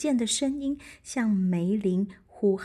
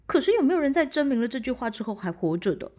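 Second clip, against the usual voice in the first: rushed; 3 semitones higher, 4 dB louder, much faster than usual. Acted. Knight hurried worry.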